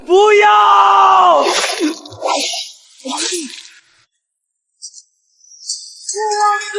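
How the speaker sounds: drawn-out cry of his name; anguished scream. Acted. Nails strike, she screams his name.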